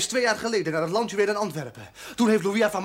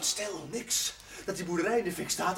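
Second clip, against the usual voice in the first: softer, hushing; hushed; 8 dB quieter than usual. Acted. René hushing the chaplain, softly conspiratorial. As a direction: keep it down, conspiratorial.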